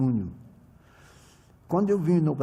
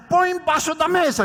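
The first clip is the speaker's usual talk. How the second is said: high, loud bossy order; a light mock boss voice; imitating the boss; 13 semitones higher, 7 dB louder than usual. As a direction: boss telling him to hide the bottles. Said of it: "Aí ela dizia".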